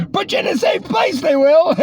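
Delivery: high mocking sneer; sarcastic high voice. Mocking continues.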